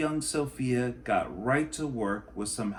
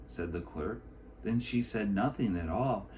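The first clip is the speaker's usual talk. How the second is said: usual speaking voice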